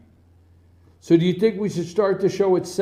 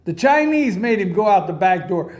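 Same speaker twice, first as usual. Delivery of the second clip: ordinary talk, nothing marked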